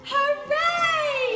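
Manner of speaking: high, loud hooray; drawn-out celebratory shout; cheering. Acted. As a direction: lead the group in a big cheer. Everybody cheers, leading children to repeat.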